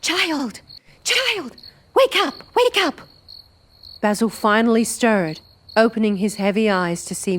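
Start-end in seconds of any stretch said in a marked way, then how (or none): 0.0-3.0 put-on high frantic calls to wake the child; urgent, panicked waking call. Woman waking boy in panic.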